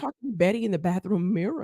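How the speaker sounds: ordinary talk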